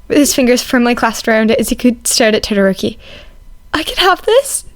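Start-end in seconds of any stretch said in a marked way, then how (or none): 3.7-4.6 put-on timid boy voice. A narrator's reading. Izuku voiced, stunned by kindness.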